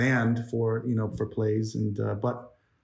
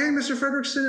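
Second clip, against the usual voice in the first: put-on eager little boy, pitched way up; high-pitched kid voice; 13 semitones higher than usual. Shown as a demonstration. Animator demoing the boy's scratch voice, high-pitched and eager. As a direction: chirp it like a cheerful young scout. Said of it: "trying to get into a high-pitched voice".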